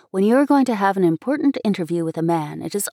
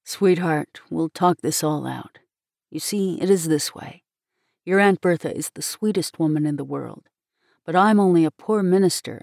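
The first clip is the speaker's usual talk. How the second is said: lower, resigned man's voice; lightly voiced gentle minister; 3 semitones lower than usual. Poor minister explaining his hopeless love to the girl, gently resigned.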